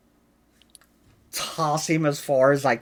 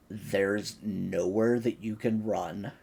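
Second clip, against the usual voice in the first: menacing in the ear; 5 semitones lower, 7 dB quieter than usual. Acted. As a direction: lean in close and threaten softly. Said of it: "lean kind of into his ear".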